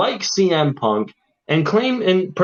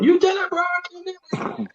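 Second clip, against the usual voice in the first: mock yelling. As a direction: squeal it out like someone yelling in the ring. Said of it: "he's just sitting there yelling".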